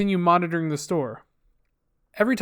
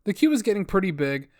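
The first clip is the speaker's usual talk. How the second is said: plain reading voice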